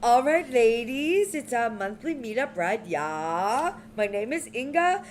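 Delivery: hammed-up Swedish host greeting the ladies; Swedish accent as Inga. Greeting the ladies' ride in a mock Swedish accent, laughing. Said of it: "speak Inga language".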